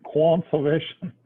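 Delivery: slow, rising "Conservation" in a mock foreign accent; silly made-up accent. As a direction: drag out "Conservation" in a half-hearted joke accent. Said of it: "I don't know what accent we're doing".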